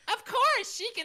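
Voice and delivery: high, slow, sugary wife voice; Amy Rose voice. Shown as a demonstration. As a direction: chirp it high and sweet. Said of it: "you have to do it as Amy Rose".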